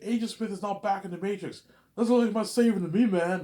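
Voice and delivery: high, loud retold rant; acting mad. Hero arguing Neo saved nobody, acted angry rant. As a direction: argue it heatedly at full voice. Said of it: "he's all mad".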